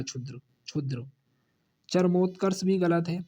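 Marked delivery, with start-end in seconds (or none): 0.0-1.1 softer, careful क्षुद्र; soft model word. Shown as a demonstration. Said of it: "क्षुद्र इस प्रकार लिखा जाएगा". (धीमे से क्षुद्र)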